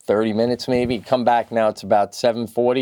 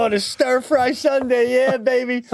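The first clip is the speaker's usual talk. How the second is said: high-pitched mock-delighted cheer; mock relish; 11 semitones higher than usual. Imagined diners looking forward to Sunday stir fry, mock relish.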